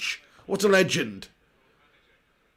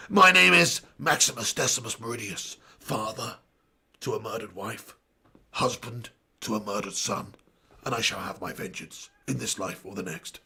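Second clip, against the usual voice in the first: low, quiet, measured vow of vengeance; solemn gladiator; 9 semitones lower, 5 dB quieter than usual. Acted. Streamer playing Maximus for the chat, low solemn gladiator. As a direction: deliver it low and grave, pausing between phrases.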